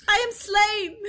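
high, theatrical dying cry; hammed-up death wail; Polonius. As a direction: cry out melodramatically as if fatally stabbed. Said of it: "and Polonius just goes".

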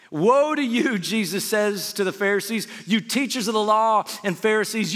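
full-force preaching. Acted. Pastor preaching scripture to the church, loud and fervent. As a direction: preach it hard to the congregation.

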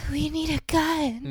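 high, slow, breathy imitation; mock breathy starlet voice. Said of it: "in her breathy ass voice".

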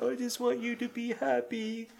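high, sneering mimicry of a child; mock him back in a cruel, high voice; mocking imitation. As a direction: cruel parroting of a boy. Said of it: "she starts to mock him too".

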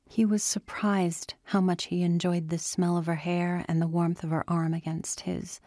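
usual speaking voice

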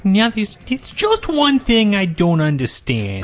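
high, slow, drawn-out mocking impression; exaggerated high detective voice, dragged out; Columbo. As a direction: send up a bad detective impression. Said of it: "stop making fun of my Columbo impression".

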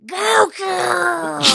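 high, loud cartoon shout; big comic impression, called out; King Kai. Shown as a demonstration. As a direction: holler it out in a silly high voice. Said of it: "your King Kai voice".

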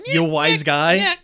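slow, loud slapstick impression; comic put-on voice, projected and drawled; Three Stooges. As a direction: imitate a stooge's taunt, dragged out. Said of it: "speak Three Stooges lines".

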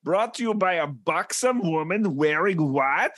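hammed-up German scolding, relishing every word; mock German accent. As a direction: play it big with a put-on German accent. Scolding in German food character.

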